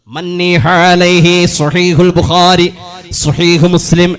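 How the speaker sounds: slow, loud declaimed Arabic; hadith recitation. Acted. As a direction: recite the chain of narrators with force.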